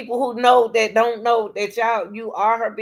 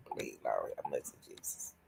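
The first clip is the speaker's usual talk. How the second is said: breathy whisper to the Lord; whispered prayer; 18 dB quieter than usual. Real, not acted. Whispering to herself after the rant, asking for mercy. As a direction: pray under your breath.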